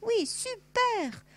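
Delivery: happy exclamation. Pretend. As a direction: cheer like a delighted child. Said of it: "quand on est content, qu'on fait". (s'exclamer de joie, très aigu et fort)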